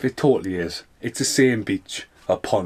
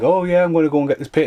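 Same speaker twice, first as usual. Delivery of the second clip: quick, higher and louder mock-cheerful line; mock cheerful victim; 6 semitones higher, 5 dB louder, faster than usual. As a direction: ham up the clueless guy walking to his death. Mocking doomed character's line.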